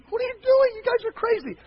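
put-on a kid outraged at his cousins; exasperated kid. Young Tim yelling at his toy-wrecking cousins.

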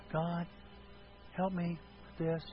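soft, low-energy prayer; small, subdued request to God; meek, quiet plea. Shown as a demonstration. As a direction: pray it timidly, with long hesitations. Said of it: "Does it mean this?".

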